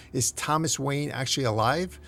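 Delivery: ordinary delivery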